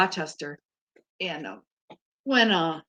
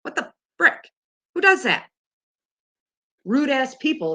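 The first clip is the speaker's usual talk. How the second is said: outraged high exclamation; 7 semitones higher than usual. Real, not acted. Outraged at strangers pushing her.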